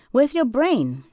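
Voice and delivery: high, loud exasperated demand; angry scolding. A narrator's reading. Woman telling off boy with the snowball, angry scolding.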